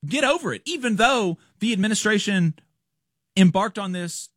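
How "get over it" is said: mock dismissive. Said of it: "really just telling people".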